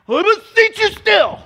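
very high, loud theatrical command; mock exorcist shout. Preacher parodying The Exorcist against a simple rebuke, high hammed-up bellow. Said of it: "it wasn't like The Exorcist".

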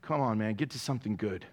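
preacher voicing a bored listener, low mock grumble; imitating an impatient listener. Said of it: "Others are like".

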